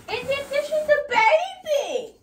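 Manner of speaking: very high, loud, slow cooing squeal; cutesy baby talk. Acted. Cooing over the smallest vase.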